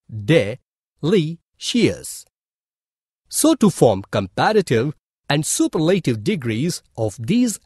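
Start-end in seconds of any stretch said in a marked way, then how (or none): 0.1-2.3 "de, li, shious" said as three separate syllables with pauses; split into syllables. Shown as a demonstration. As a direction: say "de", "li", "shious" one at a time. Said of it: "has three vowel sounds".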